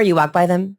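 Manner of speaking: ordinary talk, nothing marked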